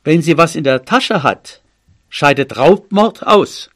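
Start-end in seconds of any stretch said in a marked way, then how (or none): none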